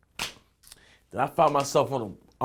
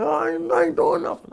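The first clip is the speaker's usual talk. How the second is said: much higher, pleading voice; imitating the panicked man, mild mock scream; lightly voiced frightened man; retold scared screaming. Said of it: "He's screaming".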